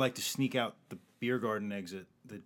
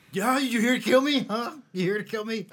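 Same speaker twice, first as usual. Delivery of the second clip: high, loud, heated challenge; raised-voice accusing grilling; threatening demand; 9 semitones higher, 5 dB louder than usual. Acted. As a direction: confront him hard by the collar. Acted confrontation, grabbing Marky by collar.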